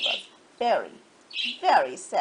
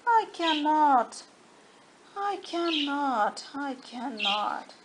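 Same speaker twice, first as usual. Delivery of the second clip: put-on weary old engine; 2 semitones higher than usual. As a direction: drag every word out like an exhausted old engine.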